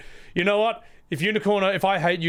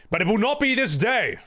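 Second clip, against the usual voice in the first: Aragorn quote; 6 semitones higher than usual. Retold or imitated. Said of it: "as Aragorn would say".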